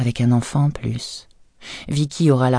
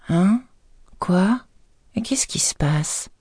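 high, drowsy confusion fading to a whisper; just woken and lost; wife roused from sleep, groggy and confused; sleepy; 6 semitones higher, slower than usual. Acted. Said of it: "d'une voix ensommeillée".

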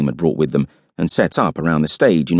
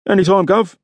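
high, cheery driver's voice; chirpy, much higher pitch; cheerful; 12 semitones higher than usual. A narrator's reading. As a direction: toss it off brightly as the driver. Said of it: "said the driver cheerfully".

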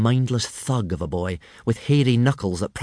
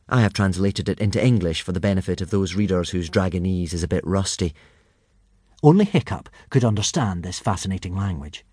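ordinary delivery

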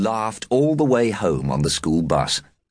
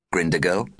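ordinary delivery, nothing marked

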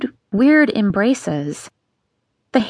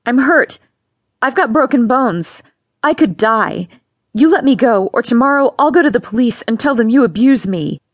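put-on a hurt girl threatening her father; defiant threats; 2 semitones higher, 3 dB louder than usual. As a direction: fight back with threats. Vera threatens Papa.

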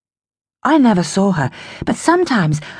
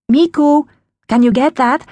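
raised voice, calling across the flat; calling from kitchen; 7 semitones higher, 4 dB louder than usual. Acted. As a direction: call a child from another room. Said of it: "Mum called from the kitchen".

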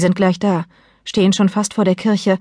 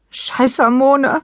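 tearful exasperation; 7 semitones higher, much slower than usual. A narrator's reading. Tearful mother curses her hormones.

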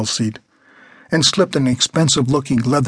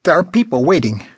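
put-on a pushy old lady, pitched up; bossy old woman; 5 semitones higher, 3 dB louder than usual. A narrator's reading. Old woman ordering a young man out of his seat, pitched up. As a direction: demand it like a rude old woman.

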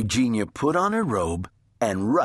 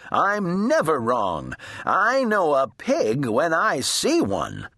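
high, emphatic, mildly shouted retort; indignant shout; 6 semitones higher than usual. A narrator's reading. Eugenia defends herself to her sister, mild indignant shout. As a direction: insist with a touch of shouting. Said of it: "Eugenia shouted".

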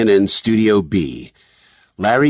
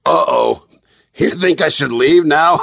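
joking TV host, higher, breaking into laughter; mock horror; 7 semitones higher than usual. A narrator's reading. Host teasing guest about being doused, mock horror. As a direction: play-act alarm, then laugh it off. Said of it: "Kent faked a look of horror".

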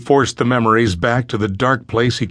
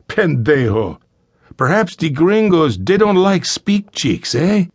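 put-on higher, taunting Spanish-accented thug; Spanish-accented English; 7 semitones higher than usual. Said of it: "He addressed the fathers in accented English".

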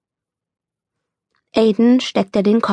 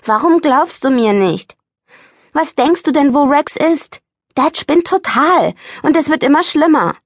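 higher, desperate pleading; upset pleading; 8 semitones higher, 4 dB louder than usual. A narrator's reading. Boy pleading with his disbelieving mother, higher and upset. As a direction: make her believe you, urgently.

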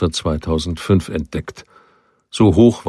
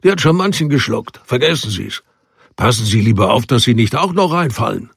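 higher, raised warning over the water; calling a warning; 5 semitones higher than usual. A narrator's reading. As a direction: call out the grim warning. Schoch warning the strangers.

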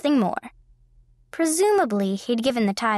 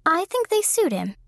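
higher, playful and amused; irrepressible humor; 9 semitones higher than usual. A narrator's reading. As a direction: tease with a grin you can hear. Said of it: "with irrepressible humor".

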